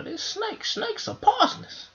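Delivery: hammed-up high fright; alarmed squeak. Suspicious of the snake.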